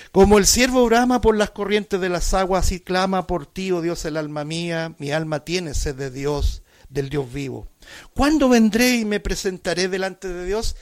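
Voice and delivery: preacher declaiming the psalm to the congregation; fervent scripture reading. Acted. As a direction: read the psalm aloud like a cry to God. Reads psalm aloud fervently.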